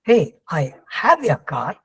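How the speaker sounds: "have" stressed, slow and deliberate. Shown as a demonstration. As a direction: punch the word "have" in a slow, spaced line. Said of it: "it's his car and not his father's car".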